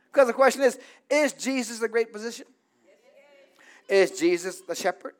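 normal talking voice

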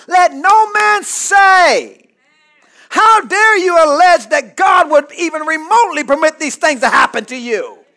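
loud, high preacher's scolding; indignant rebuke. Acted. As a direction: thunder it out as an outraged apostle. Acts out James's angry rebuke.

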